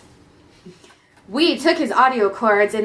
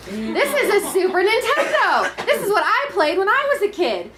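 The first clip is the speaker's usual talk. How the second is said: proud excited dad; 8 semitones higher than usual. Acted. Proud dad voiced in story.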